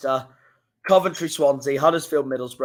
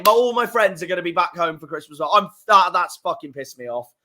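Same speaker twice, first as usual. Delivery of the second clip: ordinary delivery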